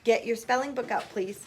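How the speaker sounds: usual speaking voice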